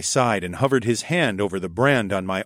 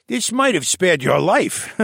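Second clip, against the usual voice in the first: put-on raised, lively old scholar voice; old koala elder voice; 9 semitones higher, 3 dB louder than usual. A narrator's reading. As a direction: voice the old archivist, a touch higher. Tovish speaks.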